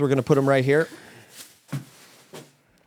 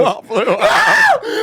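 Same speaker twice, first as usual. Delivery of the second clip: loud drawn-out guess; 4 dB louder, much slower than usual. Real, not acted. Joking repeat of wrong guess.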